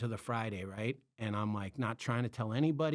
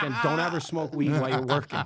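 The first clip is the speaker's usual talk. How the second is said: louder scolding through laughter; mock scolding; 3 semitones higher, 7 dB louder than usual. Boss scolding the assistant, a louder, laughing mock scolding. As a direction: tell him off, half laughing.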